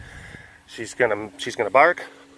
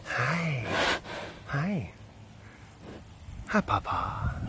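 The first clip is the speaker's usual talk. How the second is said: soft greeting; 3 semitones higher, 10 dB quieter, slower than usual. Real, not acted. Greeting an animal softly.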